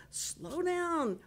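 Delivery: thin-volume, high-pitched pretend child's yelling; yelling grandchildren. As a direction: imitate grandkids squealing warnings from the back seat. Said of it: "they're always yelling at me".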